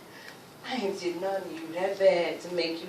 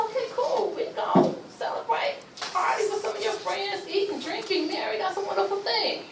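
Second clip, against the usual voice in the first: naive cheerful agreement; 10 semitones higher than usual. Retold or imitated. Naive wife agreeing to party.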